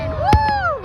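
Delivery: high, loud woo; excited whoop. Real, not acted. Celebrating Canada's birthday, excited high whoop. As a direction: let out a loud, high cheer.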